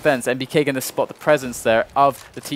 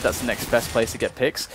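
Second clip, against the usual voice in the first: normal talking voice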